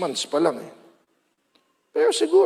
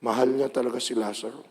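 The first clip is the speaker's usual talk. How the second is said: tender crowd; 7 semitones lower, 7 dB quieter, faster than usual. Acted. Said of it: "Ang sabi nila".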